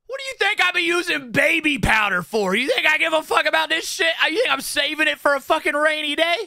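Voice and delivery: a hyped-up rant, voice up high; excited rant. Real, not acted. Streamer defending using lots of baby powder, hyped loud rant.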